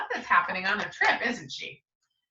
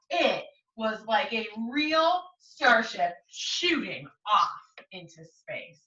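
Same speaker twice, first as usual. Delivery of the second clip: usual speaking voice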